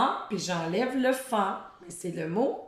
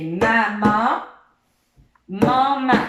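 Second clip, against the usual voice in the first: louder demo; 9 dB louder, much slower than usual. Shown as a demonstration. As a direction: show the word loud and clear. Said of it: "en inversant les prénoms".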